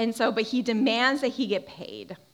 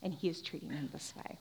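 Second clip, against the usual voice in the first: usual speaking voice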